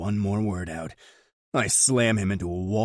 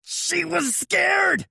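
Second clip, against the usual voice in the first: very high, louder, slow yell; 6 dB louder, much slower than usual. Acted. Choked brother yells back the truth. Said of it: "he yells".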